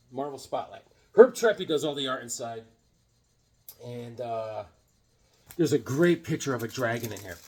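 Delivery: ordinary talk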